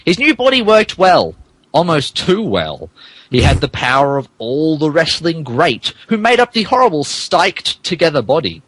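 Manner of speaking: ordinary delivery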